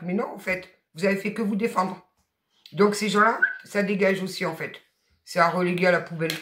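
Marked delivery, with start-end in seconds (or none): none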